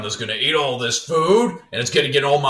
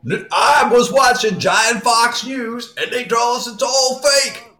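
Southern giant voice; 7 semitones higher than usual. Pretend. Giant voice continues.

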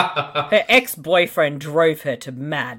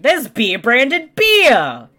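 slow, loud and pitched up; drawn-out excited exclamation; excited callout; 8 semitones higher, 6 dB louder, much slower than usual. Real, not acted. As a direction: point it out with delight. Spots product placement on screen.

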